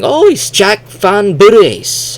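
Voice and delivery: high, loud, drawn-out mock explanation; imitated Japanese developers. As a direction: insist on the name in a stretched, high voice. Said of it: "they keep saying".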